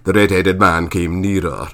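ordinary delivery, nothing marked

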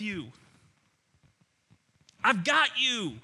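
high, forceful, emphatic assurance; Jesus, impassioned. Acted. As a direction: build from quick reassurance to loud, ringing declaration. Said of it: "He's going".

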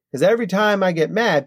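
ordinary delivery

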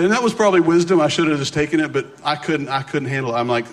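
normal talking voice

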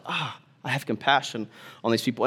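hushed caring voice; gentle compassion. Retold or imitated. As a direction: soothe them with pity.

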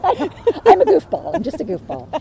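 high, loud laughing admission; real laughter through the words. Real, not acted. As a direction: laugh it out, slowly. Laughing at herself.

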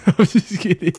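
laughing. Real, not acted. Laughs off the joke.

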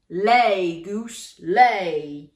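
loud giant command to the goose; giant's cry. A narrator's reading. Giant crying to the goose to lay. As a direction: call it out like a giant giving orders. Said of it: "he cried".

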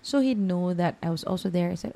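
ordinary delivery

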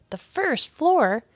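can't believe his ears; disbelieving. A narrator's reading. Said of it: "Keith could not believe it".

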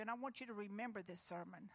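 ordinary talk

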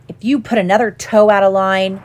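ordinary delivery, nothing marked

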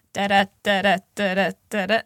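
da da da da da da da da in a steady da-DUM beat; heartbeat rhythm. Shown as a demonstration. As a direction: tap out the iambic heartbeat. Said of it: "it goes".

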